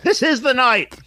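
high-pitched acted yell; exasperated outburst. Quoting the film's zombie acting lesson, exasperated outburst. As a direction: act out a high, insistent shout.

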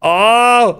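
startled yelp. Retold or imitated. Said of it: "he's like".

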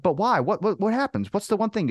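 high, insistent retold questions; acted-out baffled protest; incredulous questioning. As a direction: demand the reason, pitch way up. Retold pleading for a reason.

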